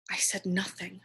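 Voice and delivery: softly whispered admission. Real, not acted. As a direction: whisper it, ashamed. Quiet guilty admission.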